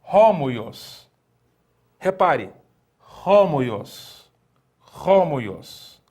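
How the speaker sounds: homoios started with a breathy h; Greek reading. Shown as a demonstration. Teacher reads the Greek word aloud to students. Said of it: "A palavra, por exemplo".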